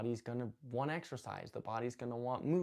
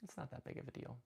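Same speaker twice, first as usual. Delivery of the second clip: much softer, letting it go; calm self-talk; 12 dB quieter, faster than usual. Retold or imitated. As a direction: drop to a soft, reassuring aside. Calm response replacing road rage.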